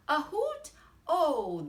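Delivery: high, loud questioning exclamation; surprised mouse. Acted. Mouse hearing of a hoot in the trees, surprised. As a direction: pitch leaps up in acted surprise.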